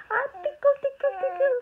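high, loud tickle singsong; playful tickling. Real, not acted. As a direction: squeal it while tickling. Tickling the baby.

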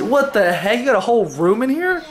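usual speaking voice

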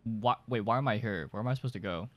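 normal talking voice

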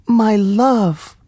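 high, ardent princess voice; crying out. A narrator's reading. Princess to her beloved Aladdin, crying out high. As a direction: address a sweetheart in a high, heartfelt cry. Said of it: "cried Princess Zadia".

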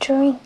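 "Drink" said slowly and clearly for learners; "Drink" in English; repeat after me. Shown as a demonstration. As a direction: model the word "Drink" clearly. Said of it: "Y se escribe así".

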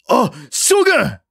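slow, high anxious call; alarmed cry. A narrator's reading. Soldier calling to the general, alarmed cry. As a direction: call out in sudden worry.

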